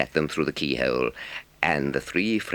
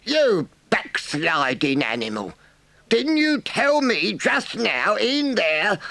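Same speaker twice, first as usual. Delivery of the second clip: scandalized badger; 3 dB louder, slower than usual. A narrator's reading.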